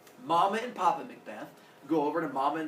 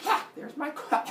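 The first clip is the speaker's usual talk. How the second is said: higher, bright ha then the find; happy discovery; 10 semitones higher than usual. Acted. Girl arriving in the underworld finding her coin, gleeful. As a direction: spot your coin with glee. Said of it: "she'd show up like".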